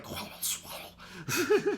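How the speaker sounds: a mock Bumblebee whisper-squeak; squeaky whisper. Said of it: "It comes out like".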